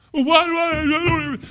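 high, loud mock child whining; whining child protesting; whiny child. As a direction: imitate a kid whining why.

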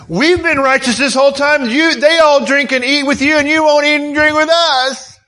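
high-pitched aggrieved rant, building; worked-up, indignant protest; emotional indignation. Acted. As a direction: complain as the slighted Pharisees, pitch staying up. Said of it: "I'm getting a little emotional here".

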